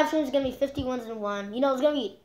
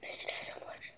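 whispering; 19 dB quieter than usual. Acted. Unvoiced whisper, cry.

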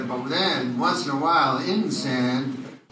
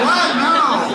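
high, loud startled what; shocked what; 11 semitones higher, 6 dB louder than usual. Acted. Doctor startled by Larry's question, loud high shock. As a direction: blurt it out, caught off guard.

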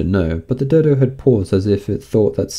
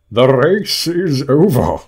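loud, high, drawn-out announcement; calling out; 5 semitones higher, 6 dB louder, slower than usual. A narrator's reading. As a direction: call it out slowly to the whole crowd. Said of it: "the dodo suddenly called out".

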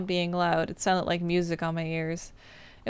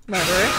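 creature laugh; 6 semitones higher than usual. Acted. Returning eye creature taunting the player, eerie laugh. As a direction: cackle and accuse like a returning ghost.

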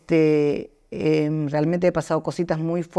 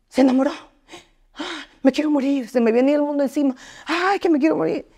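hammed-up despair, higher; a half-joking wail of heartbreak, pitched up; mock despair; 6 semitones higher than usual. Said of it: "Y yo".

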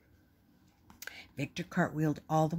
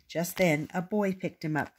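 usual speaking voice

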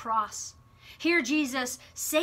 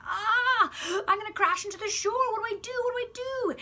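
much higher, fast panicky cry; freaking out; 11 semitones higher, faster than usual. Acted. The little wave as it sees the shore coming, much higher fast panic. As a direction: panic like a tiny wave about to crash. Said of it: "it starts freaking out saying".

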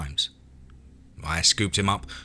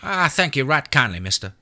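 put-on high, louder well-mannered country boy; a boy remembering his manners; high child's thank-you; polite kid; 8 semitones higher, 4 dB louder than usual. A narrator's reading. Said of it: "seemed to remember his manners".

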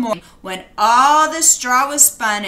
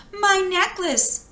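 tiny pitched-up answer; timid girl, slow and high; 8 semitones higher, slower than usual. A narrator's reading. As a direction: say it small and unsure. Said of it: "answered the girl".

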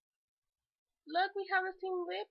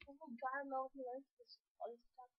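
normal talking voice

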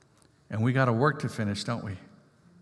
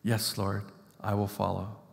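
humble quiet vow; 6 semitones lower, 8 dB quieter than usual. Retold or imitated. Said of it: "Will you follow me".

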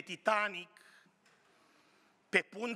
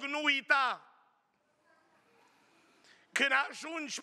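mother calling out; 5 semitones higher than usual. Retold or imitated. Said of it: "mama a mai strigat odată în noapte". (strigătul mamei, repovestit, mai sus și mai tare)

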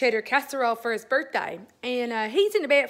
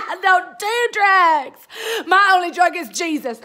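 mock wailing; 5 semitones higher, 8 dB louder than usual. Wailing after the shock.

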